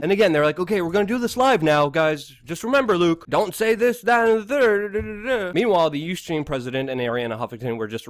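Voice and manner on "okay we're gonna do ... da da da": higher, louder imitation running off into babble; hammed-up string of bossy reminders; mock nagging producer. Producers warning guest before going live, mock nagging.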